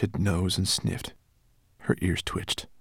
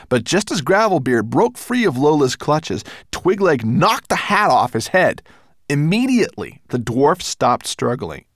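louder, higher action-scene narration; dramatic story narration; 7 semitones higher, 6 dB louder than usual. A narrator's reading. Narrator telling of the fight with the dwarf, excited narration. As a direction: drive the struggle along with urgency.

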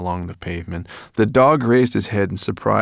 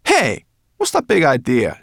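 irritated; 8 semitones higher, 7 dB louder than usual. Acted.

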